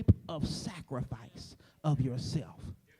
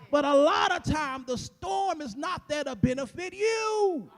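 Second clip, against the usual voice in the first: higher and louder, shouting the sermon up to a peak; preaching at full force; 8 semitones higher, 8 dB louder than usual. Acted. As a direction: build it like a Sunday whoop, climbing each phrase. Preacher builds to full whoop.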